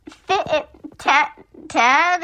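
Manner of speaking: "Fit it, Ted" lisped and pitched high; weird lisp. A narrator's reading. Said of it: "with a really weird lips, lisp, lisp".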